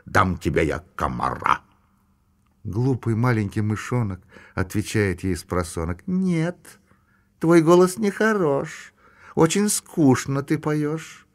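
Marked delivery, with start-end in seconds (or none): 0.0-1.6 lower, self-important toad singing; a toad nanny croaking a pompous lullaby. A narrator's reading. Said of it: "важно квакать". 6.1-11.3 put-on mouse child. A narrator's reading. Baby mouse complains.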